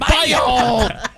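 high yelling with a laugh; laughing scold at the dog. Shown as a demonstration. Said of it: "pretend like he just jumped onto the counter".

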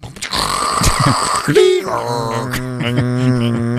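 imitated screechy modem connecting; long, high electronic screech done by mouth; dial-up modem noise. Imitating his old dial-up connection, modem screech. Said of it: "look into it on my dial-up".

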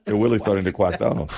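quick mimicry, "Willie's" for "really"; cartoonish W-for-R speech on "Willie's"; Elmer Fudd. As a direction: imitate R turned to W in "Willie's" and "quack". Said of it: "That's Elmer Fudd".

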